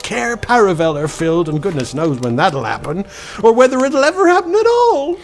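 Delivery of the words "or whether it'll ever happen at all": fast high laughing sob; a fawn tipping into panic; hysterical despair. Acted. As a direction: let it crack into a desperate laugh. Fawn's despair cracks.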